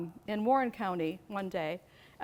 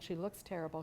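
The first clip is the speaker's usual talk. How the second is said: usual speaking voice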